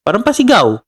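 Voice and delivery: a quick demo of his shouting voice; mock shout. Shown as a demonstration. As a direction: show how he yelled last week. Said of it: "Hindi ako sanay na sumisigaw".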